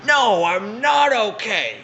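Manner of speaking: put-on high, drawn-out quavery old woman, slow; retold old lady in pain, high and slow.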